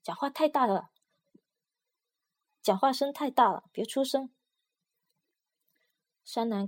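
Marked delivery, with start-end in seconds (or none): 0.0-4.3 a raised, vicious scold; fierce scolding. A narrator's reading. 商人在外面凶狠地喝止盒子里的说话声. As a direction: hiss him down with menace. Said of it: "恶狠狠地训斥".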